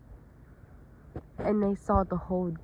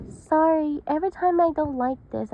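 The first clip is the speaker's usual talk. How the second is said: raised and quick, embarrassed; high flustered apology; 8 semitones higher, 5 dB louder than usual. Acted. Sally apologizes to the friends, high and flustered. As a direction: rush out the sorry.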